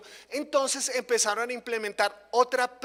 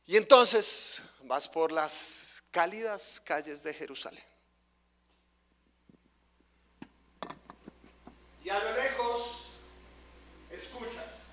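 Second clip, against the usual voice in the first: guided visualization; 6 semitones lower than usual. Acted. Guided imagery with eyes closed.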